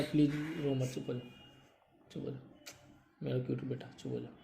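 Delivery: very soft, low hush to someone crying; gently calm a crying person, almost under the breath; soft consoling. Real, not acted. (बहुत धीमे, प्यार से चुप कराते हुए)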